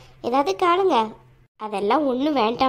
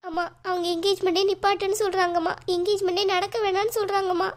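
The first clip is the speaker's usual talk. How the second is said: high, plaintive weepy mother; tearful; 5 semitones higher than usual. Acted. Mother telling daughter the engagement is being stopped, tearful. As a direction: break bad news in acted weeping. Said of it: "ஒழுங்கா நீங்க முதல்ல அழாம".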